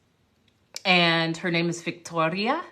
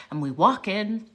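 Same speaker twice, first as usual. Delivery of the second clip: usual speaking voice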